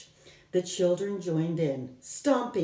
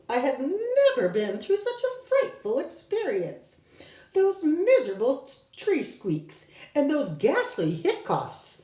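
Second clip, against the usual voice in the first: shaken professor; 9 semitones higher, 3 dB louder than usual. Acted.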